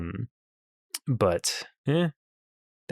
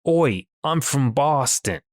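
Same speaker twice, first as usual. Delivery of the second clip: slow, higher, badly faked Boston accent; 5 semitones higher, slower than usual. Imitating a podcast host's running gag, bad Boston accent. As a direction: draw out a mock Boston accent. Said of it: "he does a Boston accent".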